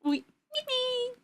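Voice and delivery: high little creature squeaks; little high-pitched "wee" cries; Pikmin noises. Shown as a demonstration. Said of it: "We did Pikmin".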